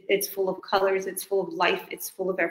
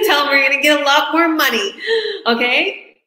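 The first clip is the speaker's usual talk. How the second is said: much higher and louder, laughing through it; 8 semitones higher, 10 dB louder than usual. Real, not acted. Owner laughing to a new partner about the bank, high and loud. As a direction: burst out laughing while you say it.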